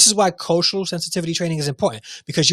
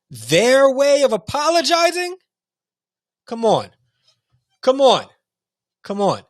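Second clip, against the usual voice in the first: outraged; 9 semitones higher than usual. Real, not acted. Outraged rant at headmaster.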